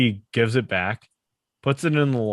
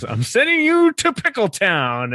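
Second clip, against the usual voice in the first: much higher, louder goofy Danny; mock Danny voice; 11 semitones higher, 6 dB louder than usual. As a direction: pitch it way up like a silly sitcom dad. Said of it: "but Danny says".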